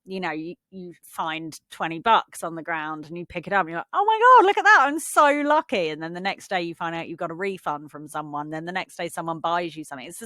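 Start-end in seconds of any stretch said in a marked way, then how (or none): 3.9-5.9 high, loud burst of mock excitement; acted thrilled delight. Someone finds twenty bucks on the ground, acted thrilled. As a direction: squeal it like a lucky find.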